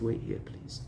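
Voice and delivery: whispered request; softly asking the driver to wait, whispered; quiet. Retold or imitated. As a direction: breathe it, barely voiced. Man asks driver to wait.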